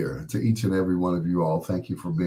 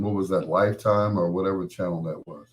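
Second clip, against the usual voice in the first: normal talking voice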